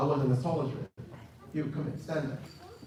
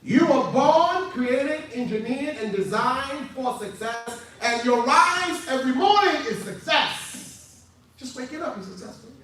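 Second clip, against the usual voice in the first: rousing slow preaching, pitched up; 8 semitones higher, 3 dB louder, slower than usual. Real, not acted. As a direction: proclaim it to the crowd, stretching each phrase.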